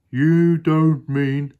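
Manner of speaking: high, loud, slow dismayed hippo; Humpy Rumpy. Acted. Hippo dismayed at the crocodile, high and drawn out. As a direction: stretch each word in high alarm. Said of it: "said Humpy Rumpy".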